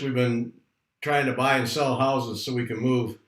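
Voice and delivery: ordinary talk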